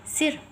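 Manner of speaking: सिर with a brief, light i; soft short i. Shown as a demonstration. Teacher showing pupils the small i vowel, soft short i. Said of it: "जो softly sound दे".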